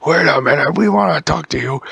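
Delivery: loud call from a distance. Acted. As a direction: shout after them to stop. Ents calling after them.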